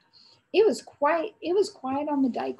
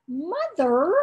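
anguished cry; 4 semitones higher, 4 dB louder than usual. A narrator's reading. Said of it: "cried Joris in anguish".

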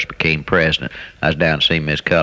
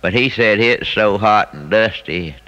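ordinary talk

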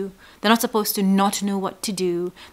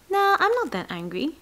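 higher-pitched, brushing-off "nah"; dismissive denial; 9 semitones higher than usual. Acted. Man denying his anger to himself, high dismissive denial. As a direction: wave it away in a higher voice. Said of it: "Now you might think".

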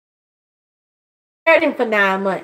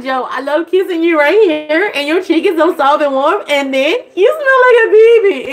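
put-on higher, doting coo to her teenage son; 5 semitones higher than usual. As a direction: coo over him like he's still a baby. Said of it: "but I'm like".

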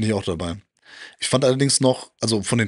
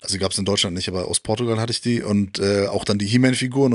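ordinary talk

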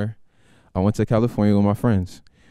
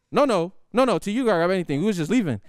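higher, hurried backing off; nervous backpedal; 8 semitones higher than usual. Retold or imitated. Nephew panicking to stop the guns, high. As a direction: scramble to take it back. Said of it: "backpedalling quickly".

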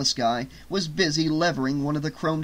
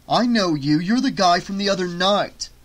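raised voice, accusing; louder, higher realization; loud recognition; 4 semitones higher, 5 dB louder than usual. Acted. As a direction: call him out as you recognize him. Eric recognizes the thief.

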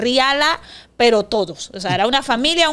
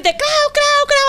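high squawking hen imitation; shrill-free high mock clucking; 4 dB louder, much slower than usual.

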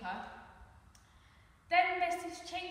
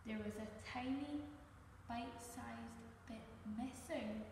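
softer, hushed reveal; 8 dB quieter than usual. A narrator's reading. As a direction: build suspense quietly. Suspense reveal.

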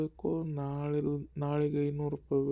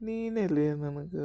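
ordinary talk

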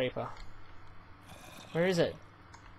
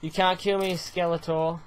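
ordinary talk

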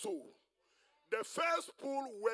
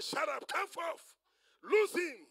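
loud, high, slow order; shouted command; 9 semitones higher, 10 dB louder, slower than usual. Acted. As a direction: command with ringing authority. Jesus commands at the tomb.

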